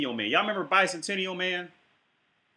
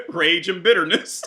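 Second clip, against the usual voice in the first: mocking laugh; 2 semitones higher, 7 dB louder than usual. Host mockingly repeats the guest's words.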